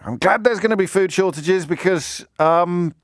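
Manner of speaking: mock Daily Mail columnist. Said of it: "What else we got?".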